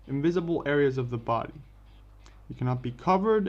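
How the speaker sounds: ordinary delivery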